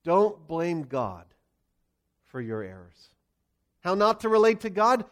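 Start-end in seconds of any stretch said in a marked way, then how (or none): 0.0-3.1 slow, weighty "Don't blame God"; emphatic preacher's point; sermon point stressed. Real, not acted. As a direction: lean hard on "Don't", then let "for your errors" drop away. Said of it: "Here's point number one".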